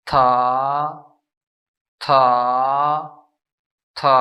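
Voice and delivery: था said with a rising pitch each time; second tone on था. Shown as a demonstration. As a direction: lift था upward. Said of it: "उठा के इस तरह पढ़ा जाएगा".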